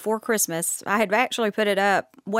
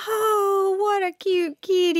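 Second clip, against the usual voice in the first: higher, sweet cooing voice; cooing friend; 10 semitones higher, slower than usual. Retold or imitated. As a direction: coo it like a friend admiring a kitty. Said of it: "And somebody goes".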